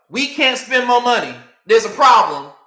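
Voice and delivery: hammed-up alarm, shouting the problem; mock outburst. Speaker mocking the government about its spending limit, loud mock shout.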